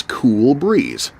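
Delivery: ordinary delivery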